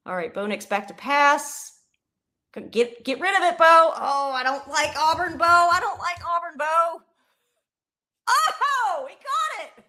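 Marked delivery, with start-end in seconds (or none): 2.6-7.0 high, loud urging at the TV; excited yelling. Real, not acted. Yelling at screen during play. 8.3-9.8 squeaky high gasp of joy; thrilled surprise. Real, not acted. Reacting to the catch.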